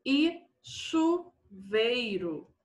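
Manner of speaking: long drawn-out syllables; slow dictation. Shown as a demonstration. Teacher giving pupils a diphthong example, slowly dictated. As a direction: spell it out by sound, slowly.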